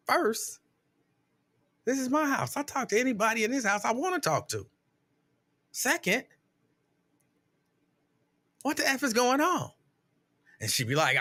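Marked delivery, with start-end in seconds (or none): 0.1-9.7 high-pitched mock indignation; retold indignant comeback. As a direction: act out an affronted husband, voice pitched up.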